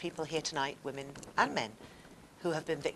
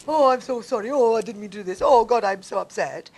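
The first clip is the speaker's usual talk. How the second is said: louder, higher, sobbing sorry; hammed-up tearful pleading; mock weeping apology; 6 semitones higher, 9 dB louder than usual. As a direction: wail the apology like a remorseful abuser.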